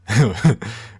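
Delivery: short, loud burst of laughter; amused laugh. Real, not acted. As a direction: laugh briefly at the funny idea. Amused at mistaken Clone Wars assumption.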